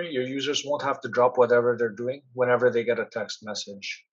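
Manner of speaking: usual speaking voice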